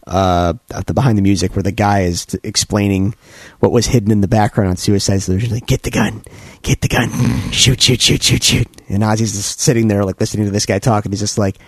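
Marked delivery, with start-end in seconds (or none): none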